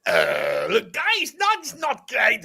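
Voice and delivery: high, drawn-out manager accent impression; a rough manager impression, still being worked on; Thomas Frank accent. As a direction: do the gaffer's accent at a press conference. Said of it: "I've been working on a Thomas Frank accent".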